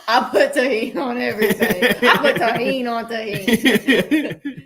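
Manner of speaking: loud, high, tickled boast; laughing exaggeration. Real, not acted. Co-host joking about tahine on everything, laughing loudly. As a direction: pile on the joke, slowing through laughter.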